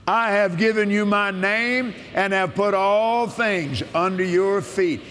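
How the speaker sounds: solemn preacher's proclamation; preaching scripture. Acted.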